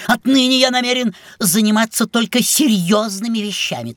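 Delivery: ordinary delivery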